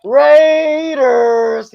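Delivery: long, high, loud "Raiders" yell; rallying team shout. Pretend. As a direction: holler it out drawn-out like a fan chant. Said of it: "can I get a Raiders".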